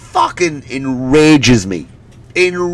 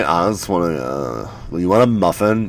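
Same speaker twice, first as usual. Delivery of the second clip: put-on bored teen barista; 8 semitones lower, 5 dB quieter than usual. Bored teen barista in coffee shop.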